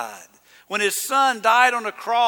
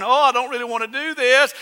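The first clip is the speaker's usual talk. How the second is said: higher, quicker mock pleading; put-on unwilling voice, pitched up; mock reluctance; 6 semitones higher, 3 dB louder, faster than usual. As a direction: imitate a half-hearted, reluctant prayer. Mock prayer Jesus would not pray.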